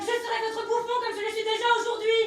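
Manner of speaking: high, loud and bitter; bitter outburst. Acted. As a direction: throw it back at her.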